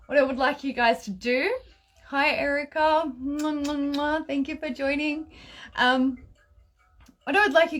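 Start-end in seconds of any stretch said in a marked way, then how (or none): 3.2-4.3 big wet smooch. Real, not acted. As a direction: blow kisses at the camera. Blowing kisses to a viewer.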